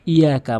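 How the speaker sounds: "ইয়াকা" with one short, light y; missing tashdid. Shown as a demonstration. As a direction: say "ইয়াকা" quickly without doubling the y. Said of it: "তাসদিদের উচ্চারণ আনে না". (তাসদিদ ছাড়া "ইয়াকা")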